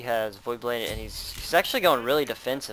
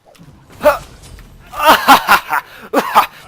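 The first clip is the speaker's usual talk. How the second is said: loud high burst of laughter; 14 semitones higher, 19 dB louder than usual. Real, not acted. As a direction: cackle out loud. Laughing at own taunt.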